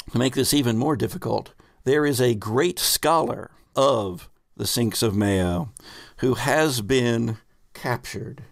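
normal talking voice

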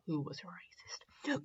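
hushed, secretive aside; whispered aside. Real, not acted. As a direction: whisper it under your breath. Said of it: "whispered aside".

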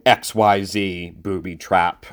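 ordinary delivery

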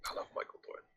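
breathy whispered confession of fandom. Real, not acted. Podcast co-host whispering her love for an actor. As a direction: whisper it like a secret crush.

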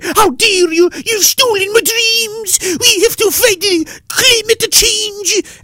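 very high, slow mocking girl voice; whiny squeaky mockery of a teen activist; mocking Greta. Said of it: "in the one hand say".

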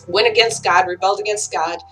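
ordinary delivery

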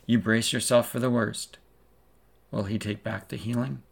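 ordinary delivery

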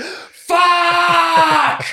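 high, loud mock howl of despair; fan's last hammed-up cry at the goal; final shout. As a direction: let out one big anguished yell. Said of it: "and then he just went".

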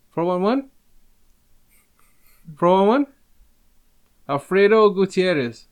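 high, loud mock Mexican accent, asking; a jokey Mexican accent pitched high. Shown as a demonstration. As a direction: ham up a Mexican accent on the numbers. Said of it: "put on your best Mexican accent".

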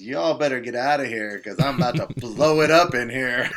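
loud retold announcement with a laugh breaking in; mock warning. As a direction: imitate someone warning the whole room. Retold man's warning to a bathroom.

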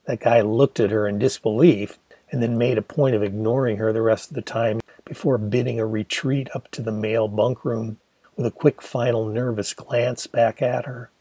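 ordinary delivery

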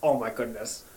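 low, very soft amazement; acting quietly awestruck; hushed awe. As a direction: drop to a stunned hush. Sudden awe stops the retold crying.

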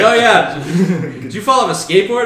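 high, fast, giggling question; laughing receptionist. Retold or imitated. Receptionist recognizing the skateboard caller, laughing.

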